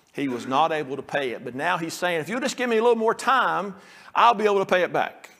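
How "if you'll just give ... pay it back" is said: quick, high pleading for more time; begging servant. Acted. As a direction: beg fast and high for a little more time.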